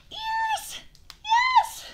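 high happy squeal; joyful squeal. Real, not acted. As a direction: squeal the yes with pure delight. She finally got her favorite ears.

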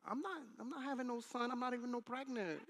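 high-pitched mock wife voice; caricatured wife. Wife denying she's having a son, hammed-up protest. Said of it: "that's not how she talks".

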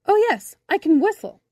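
high, loud burst of remembering; sudden eagerness. A narrator's reading. Boy remembers one skill for bumblebee, eager. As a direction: pipe up eagerly.

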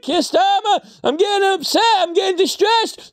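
high whiny voice; mock whiny complainer. Complaining to Keir Starmer, high and whiny. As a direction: whine like an offended citizen.

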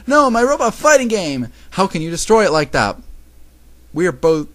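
high, loud whiny protest; loud, aggrieved complaint; whining. A narrator's reading. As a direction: whine like a sore loser. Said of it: "Misao whined".

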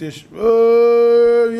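long, loud stretched "אה" searching for a word; drawn-out hesitation. Real, not acted. Drawn-out hesitation mid-list. ("אה" ארוך ומתמשך)

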